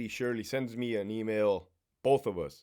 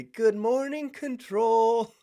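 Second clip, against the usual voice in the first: mocking the captain; 9 semitones higher, slower than usual. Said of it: "look up at the control booth and say".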